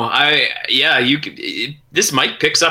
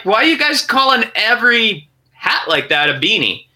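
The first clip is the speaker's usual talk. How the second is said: incredulous; 9 semitones higher than usual. Retold or imitated. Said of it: "so I'm like".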